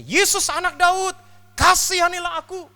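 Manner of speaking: slow, loud, high pleading cry; shouting again. Acted. Beggar shouting again as Jesus walks on, slow loud cry. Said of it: "dia teriak lagi".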